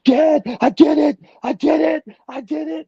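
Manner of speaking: very high imitation of an overjoyed son; excited screaming. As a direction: scream it high like a kid calling Dad, starting loud. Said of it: "like, he was screaming".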